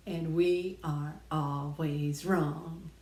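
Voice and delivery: slow and deliberate; weighty slow declaration; slow, emphatic. Real, not acted. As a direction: lean on every word.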